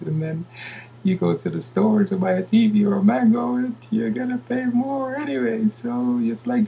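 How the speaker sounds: high, sustained singsong delivery; high put-on voice. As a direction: hold a high pitch and stretch the last word long. Carries high put-on voice to end.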